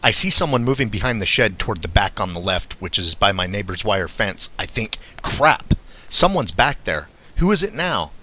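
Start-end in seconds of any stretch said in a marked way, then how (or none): 5.2-8.1 higher, louder startled reaction; alarmed inner thought. A narrator's reading. Narrator spots someone behind the shed, alarmed. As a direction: think it aloud in exasperated alarm.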